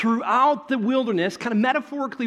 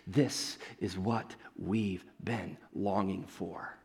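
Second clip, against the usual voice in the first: much softer, lower, slow and reverent; hushed longing; 13 semitones lower, 11 dB quieter, slower than usual. Retold or imitated. Wandering people hearing the voice, hushed awe. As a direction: breathe it like a found treasure.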